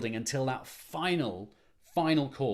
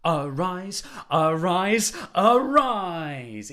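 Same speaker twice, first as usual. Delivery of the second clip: each arise louder, building to a big finish; sung crescendo; 7 semitones higher, 9 dB louder, much slower than usual. Shown as a demonstration. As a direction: grow through the three arises. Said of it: "getting louder all the time".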